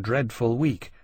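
usual speaking voice

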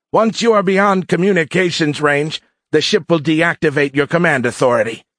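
high, forceful cadet voice; gloating alien cadet. A narrator's reading. Cadet leader explains his plan to captives, gloating. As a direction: lay out the plan in a high, loud voice.

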